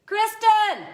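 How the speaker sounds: high-pitched, loud call to another room; shouted summons; real yell. Real, not acted. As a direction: holler the name so it carries downstairs. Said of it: "Sorry for the yell".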